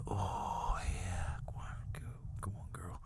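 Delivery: low, very soft breathy whisper; hushed, comically lecherous aside; whispered pleasure. Acted. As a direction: urge on in a mock-lustful whisper. Acted pleasure between fake sobs.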